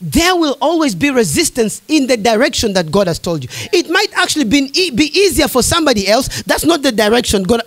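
ordinary talk

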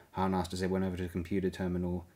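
ordinary delivery, nothing marked

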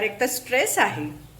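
ordinary talk

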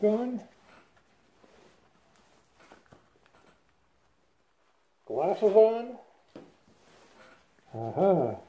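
muffled behind mask filters; covered mouth with goggles; through a respirator mask. Real, not acted. Seen 3: Half-face respirator over mouth and goggles worn throughout labelled words.

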